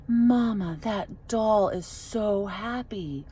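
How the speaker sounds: mother imitates her daughter finding the happy doll, softly awed; awed little girl. Daughter gasps at favourite doll.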